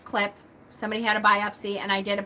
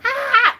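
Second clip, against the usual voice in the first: high squeal; 7 dB louder than usual. Real, not acted. Laughing at own story.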